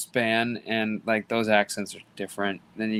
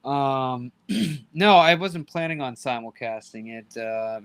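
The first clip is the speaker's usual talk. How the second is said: normal talking voice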